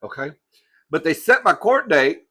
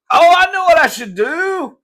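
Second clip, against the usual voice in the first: much higher, louder and slower burst of inspiration; light up with a bright idea; real excitement at a scheme; sudden idea; 10 dB louder than usual. Real, not acted. Sudden idea mid-rant.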